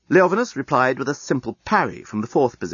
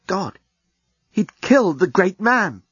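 panicked; 8 semitones higher, 3 dB louder, slower than usual. A narrator's reading. Panic after killing.

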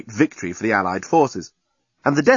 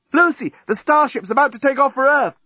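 high, louder call from afar; shouting; 14 semitones higher, 4 dB louder than usual. Acted. Journalist shouting from the breakwater, urgent. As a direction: shout across the sand. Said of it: "shouting from the breakwater".

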